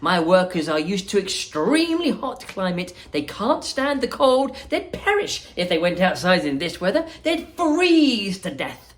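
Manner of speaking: high, lively showman voice; bubbly chocolatier welcoming guests; Willy Wonka voice. Acted.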